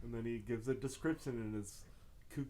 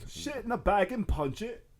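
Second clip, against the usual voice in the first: loud, higher mock Cockney outburst; Cockney accent; 7 semitones higher, 7 dB louder than usual. Joking with friends about accents, loud mock Cockney. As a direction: bellow it in a hammed-up Cockney accent. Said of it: "Or a real Cockney accent".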